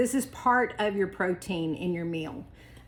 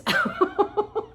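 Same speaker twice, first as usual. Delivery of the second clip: big laugh; 4 dB louder than usual. Real, not acted. After retelling absurd cheese feud.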